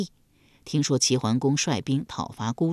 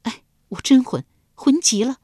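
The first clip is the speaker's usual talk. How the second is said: higher, scolding herself sharply; self-reproach; 8 semitones higher, 4 dB louder than usual. Acted. Girl scolds herself for keeping the mask on, higher and sharp. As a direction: chide yourself for the blunder. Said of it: "暗自责道".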